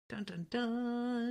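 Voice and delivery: slow, soft "dun, dun, duuun"; playful movie-sting imitation; mock suspense sting. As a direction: intone a drawn-out dramatic cliffhanger cue.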